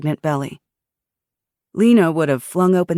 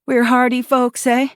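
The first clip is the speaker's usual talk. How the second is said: faint Yooper twang; 8 semitones higher, 5 dB louder than usual. Acted. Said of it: "with a faint Yooper twang".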